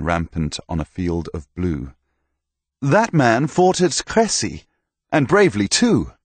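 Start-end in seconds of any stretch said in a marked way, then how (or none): 2.8-6.1 hearty knight. Acted. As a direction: praise him like a proud old soldier. Knight praises Thomas.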